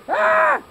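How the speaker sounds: a sharp, very high, loud "Ah!" to spook a bear; sudden startling yell. Acted. Great aunt jumps up to startle the bear with a yell. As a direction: leap up and bark one loud high cry. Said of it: "would jump up and go".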